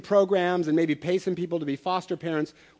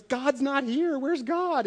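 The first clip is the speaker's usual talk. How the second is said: put-on high, worried child's voice; a light take on an upset four-year-old; upset little girl; 8 semitones higher, much slower than usual. Pastor voicing a little girl who can't find God, high and fretful.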